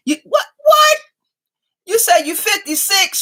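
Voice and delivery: sudden high surprised shout. Real, not acted. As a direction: blurt it out in shock. Surprised reaction to a comment.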